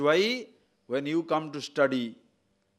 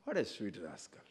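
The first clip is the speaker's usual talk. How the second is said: much softer, tender; fond and soft on What a sweet rascal; 9 semitones lower, 12 dB quieter than usual. Shown as a demonstration. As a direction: say rascal as a pet name. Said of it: "Depends on your intonation".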